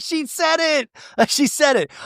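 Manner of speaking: high, giggly triumphant squeal; delighted squeal. Retold or imitated. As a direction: squeal with glee at catching it. Delighted at hearing the slip.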